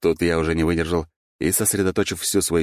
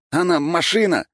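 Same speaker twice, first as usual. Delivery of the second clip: high, loud, effortful protest; straining, pitched-up complaint; strained croak; 13 semitones higher, 5 dB louder than usual. A narrator's reading. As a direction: gasp it out mid-struggle. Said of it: "прохрипел я".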